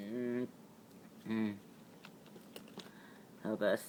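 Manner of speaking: usual speaking voice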